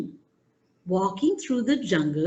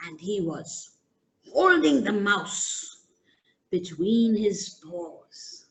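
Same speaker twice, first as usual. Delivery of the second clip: usual speaking voice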